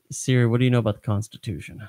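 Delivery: normal talking voice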